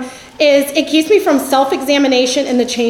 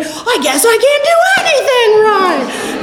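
high-pitched hammed-up wail; mock meltdown; 10 semitones higher than usual. Perfectionist hearing her own advice turned around, mock wailing. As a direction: whine it high, in exaggerated despair.

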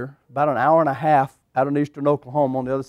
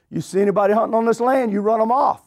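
put-on a higher, firm old-man command; papaw's stern order; 6 semitones higher than usual. Grandfather ordering his grandson to run hunters off, firm. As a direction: hand down the rule to the boy like papaw.

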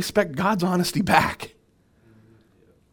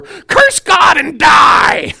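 screamed, much higher and louder, slow; shrill shout; 13 semitones higher, 10 dB louder, much slower than usual. Acted. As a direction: scream it like a scornful wife. Wife's taunt acted in sermon.

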